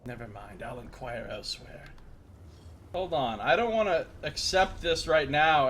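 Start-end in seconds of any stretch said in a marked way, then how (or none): 0.0-1.8 low, soft scratchy character; a bloke who can't speak up. Acted. Player turning down the job, low scratchy bloke voice. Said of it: "sound like an Irish bloke".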